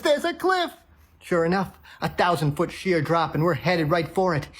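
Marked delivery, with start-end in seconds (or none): 0.0-0.7 high-pitched alarm without much volume; mock scream. Gary warning of the cliff ahead, high mock scream. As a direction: cry out a warning in comic panic. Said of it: "Gary's screaming again".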